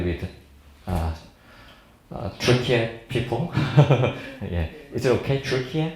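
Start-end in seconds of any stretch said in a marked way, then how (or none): none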